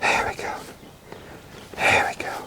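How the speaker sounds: soft whispered there we go to the horse; whispered praise. Real, not acted. Trainer whispering praise to the tired young horse. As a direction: whisper it gently to calm her. Soothing the horse after work.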